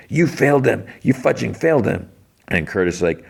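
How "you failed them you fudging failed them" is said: mock-vicious taunt, pitched a bit higher; kind of vicious. Ghost wife turning on Frank in his face, mock vicious. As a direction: spit the words right into his face, half-acted. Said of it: "in his face and kind of vicious".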